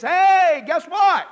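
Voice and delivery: high, very loud attention-grabbing call; urgent shout. Retold or imitated. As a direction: shout to wake up the congregation. Voices God getting people's attention.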